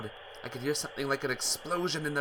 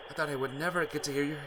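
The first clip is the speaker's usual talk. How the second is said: ordinary delivery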